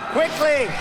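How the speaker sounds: QUICKLY! barked high and loud; urgent loud repeat. Acted. Telling Secretary Kerry to hurry up, loud emphatic repeat. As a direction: punch the word Quickly! as a command. Urging Kerry to read fast.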